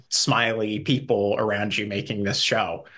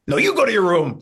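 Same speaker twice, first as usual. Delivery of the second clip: much higher and louder mock shout; Carol Kane's final comeback; 10 semitones higher, 6 dB louder, much faster than usual. Nana topping her daughter for the last word, high and loud. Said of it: "like that".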